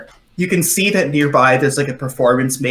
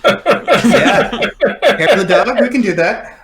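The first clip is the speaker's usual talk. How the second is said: high, laughing through the words; real laughing; 9 semitones higher, 5 dB louder, slower than usual. Real, not acted. Game master takes a silly drink order, laughing. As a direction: crack up while agreeing to the order.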